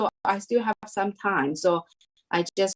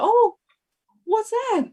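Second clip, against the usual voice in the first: much higher, breathless wonder; excited surprise. Retold or imitated. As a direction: relive the thrill of hearing it. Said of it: "So I was very excited".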